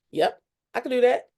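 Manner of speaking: retold eager acceptance to her mentor; eager yes. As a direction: jump at the chance.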